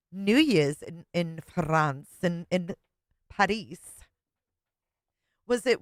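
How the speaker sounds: normal talking voice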